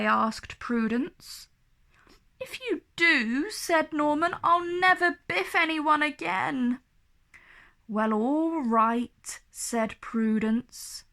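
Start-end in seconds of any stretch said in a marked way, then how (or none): 2.4-6.8 pleading. Acted. Pleading boy. 7.9-9.4 reluctant. Acted. Reluctant consent.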